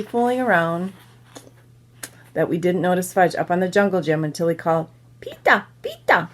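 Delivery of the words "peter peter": put-on high toddler voice saying "Pita"; toddler's pronunciation of Peter. A narrator's reading. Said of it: "That's how he says my name".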